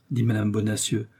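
ordinary delivery

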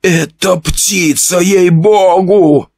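high, slow, whining plea; frightened man whining about the killer; whimpering. Acted. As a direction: whimper it in fear. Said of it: "заскулил Иван Фёдорович Орлов".

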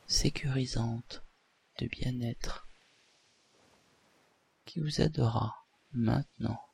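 soft, calm hypnotist induction; hypnosis voice. Acted. Leads a hypnosis session.

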